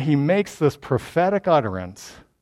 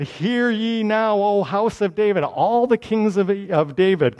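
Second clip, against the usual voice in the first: higher, sustained official summons; stately prophet calling out on a raised pitch; prophetic proclamation; 5 semitones higher than usual. Acted. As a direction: proclaim it grandly to all the kings. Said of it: "He's now in a prophetic utterance".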